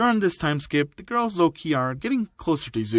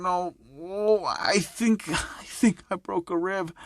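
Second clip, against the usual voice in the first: weak, higher voice, acting out pain; groaning in pain; 3 semitones higher, 4 dB quieter, slower than usual. Injured student answering his worried classmate, in pained groans. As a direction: act hurt, dragging the words out. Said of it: "groaning in pain".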